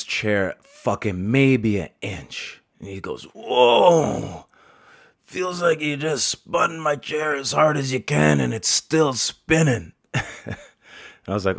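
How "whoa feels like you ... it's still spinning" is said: put-on high, dizzy old man exclaiming. Barber mimicking dizzy old man in spinning chair. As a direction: play a startled old man, pitched up.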